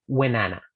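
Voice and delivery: "when Anna" run together as "whenAnna"; linked. Shown as a demonstration. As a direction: join "when Anna" with no gap at all. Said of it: "No hago pausa".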